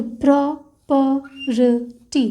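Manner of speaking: Property stretched very slowly while tracing; drawn-out word. Shown as a demonstration. Teacher tracing the stroke for students, very slow. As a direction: drag out property syllable by syllable. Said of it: "तो stroke create होगा".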